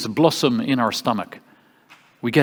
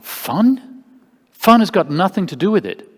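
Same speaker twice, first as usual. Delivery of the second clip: high, louder disbelieving comeback; incredulous retort; 7 semitones higher, 4 dB louder than usual. Retold or imitated. Retelling own reply to a coach asking about fun, incredulous. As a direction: throw the word back as absurd, pitched up.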